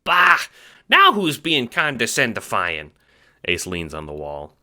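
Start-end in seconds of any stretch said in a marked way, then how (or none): 0.0-2.9 high squeaky Italian-American chipmunk; fast-talking little mobster, squeaky; Ace, chipmunk wiseguy. Acted. Little guard talks to the Seer, squeaky wiseguy.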